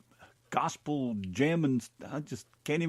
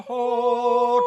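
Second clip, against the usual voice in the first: ordinary delivery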